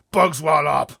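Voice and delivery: loud high booming giant. Acted. Blood Bottler booming at the BFG. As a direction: boom the insult. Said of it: "he boomed".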